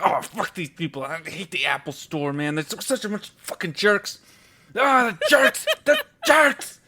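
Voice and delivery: building to a high, loud mock outburst; angry venting. Customer vents on the phone about the store, angry. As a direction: rant that climbs into comic yelling. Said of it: "and I'm all angry".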